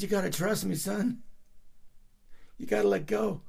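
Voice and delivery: lightly voiced dad urging his boy to let go; dad's urging voice. Dad voiced calling to son.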